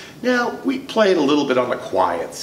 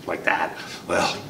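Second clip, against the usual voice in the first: ordinary talk, nothing marked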